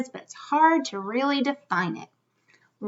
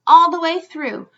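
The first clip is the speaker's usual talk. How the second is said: ordinary delivery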